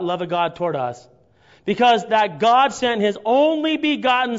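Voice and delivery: loud, slow, ringing preacher's reading; scripture reading at full force. Acted. Preacher reads First John to his congregation, loud and slow. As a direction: proclaim it to the whole church.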